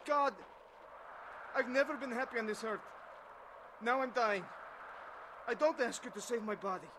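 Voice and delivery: pleading aloud to heaven; calling out to God. Acted. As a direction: cry out to God, opening and ending strongest. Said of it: "I called out to God".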